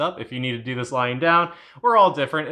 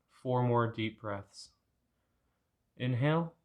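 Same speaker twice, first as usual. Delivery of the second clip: calm meditation guide, much softer; guided meditation; 7 dB quieter than usual. Acted. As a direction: talk them gently through the breaths and the painful memory. Leads a guided anger meditation.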